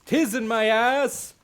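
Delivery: hammed-up ring intro yell; boxing announcer shout. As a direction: bellow the name like a fight announcer. Announcing mock boxing bout.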